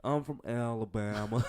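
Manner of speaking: slow, stretched Alabama drawl, laughing; drawling Southern accent. Shown as a demonstration. Trying the accent for his co-host, Southern drawl. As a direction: draw out a hammed-up Southern accent. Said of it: "that drawling Southern accent".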